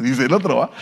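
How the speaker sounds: usual speaking voice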